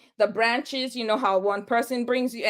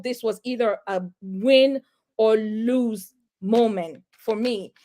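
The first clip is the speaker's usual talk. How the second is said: normal talking voice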